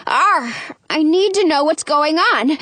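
plain reading voice

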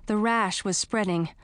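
plain reading voice

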